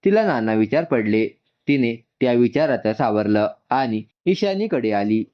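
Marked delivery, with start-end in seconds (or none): none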